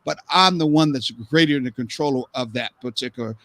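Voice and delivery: ordinary talk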